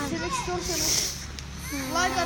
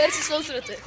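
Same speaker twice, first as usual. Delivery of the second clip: usual speaking voice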